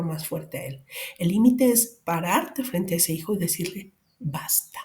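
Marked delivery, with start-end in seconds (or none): 4.2-4.8 an almost silent, breathy "Basta"; hushed whispered stop. Shown as a demonstration. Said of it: "fíjense cómo es el tono".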